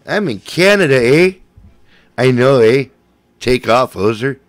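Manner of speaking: hammed-up Canadian accent; mock Canadian hoser accent. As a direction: play up the Canadian "eh". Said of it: "You said that like a native".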